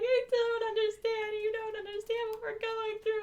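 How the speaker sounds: high, slow tearful plea; crying teenager. Retold or imitated. Crying girl pleading with her principal, high and tearful. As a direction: mimic a sobbing teen pleading. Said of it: "And I'm, like, crying".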